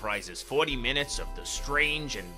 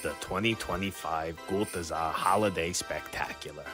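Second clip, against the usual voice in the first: announcer voice; 6 semitones lower than usual. Acted. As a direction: announce the show title. Announced title over music.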